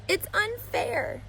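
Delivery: put-on high, slow, tearful child's protest; sobbing protest. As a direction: drag it out like a child fighting tears. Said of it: "she sobbed".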